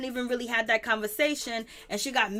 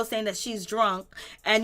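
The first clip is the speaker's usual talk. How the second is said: ordinary talk, nothing marked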